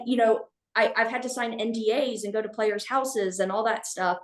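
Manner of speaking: usual speaking voice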